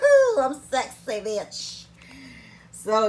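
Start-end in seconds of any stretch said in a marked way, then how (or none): 0.0-1.8 higher, louder laughing diva brag; sassy diva. Pretend. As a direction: purr it like you own the room. Sassy self-praise for camera.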